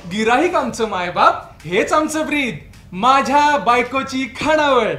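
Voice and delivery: measured advertising patter with punched tagline; marketing pitch. Acted. Husband shows wife how to sell her catering, salesman's pitch. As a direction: recite it like an advert jingle, spoken. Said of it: "Annapurna कॅटरिंगचं marketing मी सुरू केलेलं आहे".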